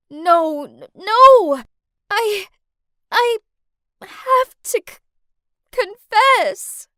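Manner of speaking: high, loud, broken-up distress; tearful stuttering. A narrator's reading. Woman believing she caused the accident confesses, tearful stutter. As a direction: stammer it out tearfully in halting bursts. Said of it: "I stuttered in tears".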